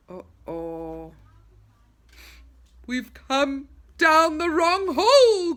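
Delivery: slow dismayed groan; a drawn-out groan of bad news. A narrator's reading. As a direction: moan it out in dismay. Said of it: "groaned Moonface".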